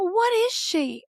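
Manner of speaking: put-on high, drawn-out childlike question; wondering little-kid voice, slow and high; insistent child. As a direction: imitate a kid pleading to know. Child demanding an answer, retold.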